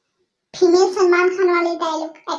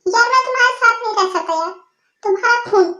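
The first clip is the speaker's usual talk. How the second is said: ordinary talk, nothing marked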